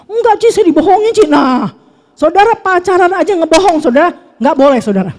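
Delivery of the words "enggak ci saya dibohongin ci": high-pitched imitation of an aggrieved wife; mock whining protest. Wife answers counsellor about being deceived, mock whining protest. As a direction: act out her plaintive denial, pitched way up.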